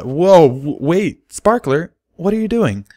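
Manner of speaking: yelping as she is pushed out; surprised cry. A narrator's reading. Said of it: "Derpy cried in surprise".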